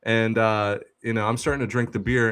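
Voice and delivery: ordinary talk, nothing marked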